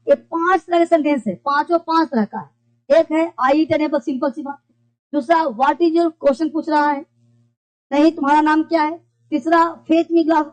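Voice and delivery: ordinary talk, nothing marked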